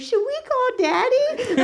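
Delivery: put-on high, tearful little-kid voice; little brother in tears. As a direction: play the scared little boy asking for help. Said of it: "Porter immediately burst into tears, and he goes".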